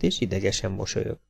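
usual speaking voice

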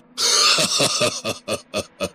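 long, high ringing laugh; acted ringing belly laugh. A narrator's reading. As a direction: laugh out in a resonant, drawn-out string of ha-ha's. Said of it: "وهو بيضحك ضحكة رنانة".

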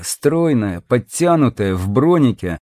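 plain reading voice

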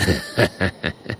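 low staccato snigger; mocking chuckle. The Murk mocking Oskar's belief, low chuckle. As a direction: tail the jibe with a low chuckle.

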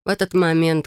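ordinary delivery, nothing marked

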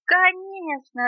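high, loud, drawn-out agreement; small creatures piping up together; eager chorus answer. A narrator's reading. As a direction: sing out a long high yes. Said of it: "ответили хором жучок и паучок".

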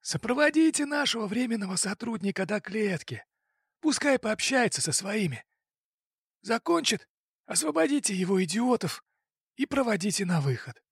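high, commanding boss voice; boss giving orders. Acted. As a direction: order the men around.

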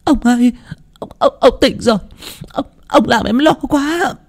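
high, halting, tearful voice; tearful blubbering. Acted. Wife seeing her husband come round, tearful blubbering. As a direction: stammer it through rising tears. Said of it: "vội mếu máo".

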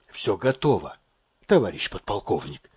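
low, subdued, laboured report; forced out with effort. A narrator's reading. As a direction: squeeze the words out softly. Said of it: "Слова выворачивались с усилием". (с усилием, тихо и низко)

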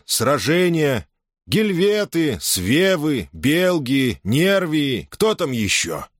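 a general shaming his soldiers; stern commander's speech. A narrator's reading. Julius addresses disgraced legion. (суровая речь полководца)

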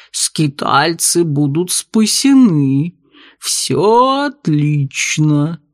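very slow, low alien voice; slow and otherworldly, calmly; gurgling tentacle alien. Acted. Octopus bartender speaks.

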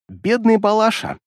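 slow, high mocking pity. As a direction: coo with false sympathy. Demoness mocks the fallen demon.

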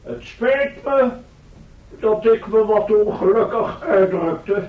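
calm the crowd from the podium; formal speech. Acted. Professor continues his address. (plechtige toespraak, soms vertraagd)

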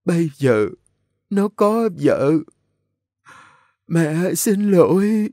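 high, slow, dragged-out weak phrases; feeble, broken speech. A narrator's reading. Dying mother apologises, feeble and halting. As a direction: labour over each short phrase, tearfully high. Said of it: "thều thào từng câu ngắt quãng".

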